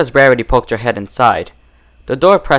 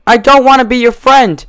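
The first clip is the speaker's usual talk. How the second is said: sobbing plea; 12 semitones higher, 6 dB louder than usual. Acted. Trapped Twilight sobbing at her captor. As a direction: wail it through tears.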